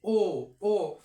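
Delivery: ओ said loud and round; teacher modelling the vowel; o demo. Shown as a demonstration. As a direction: say a full, rounded ओ twice. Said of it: "तो आप क्या बोलते हैं?".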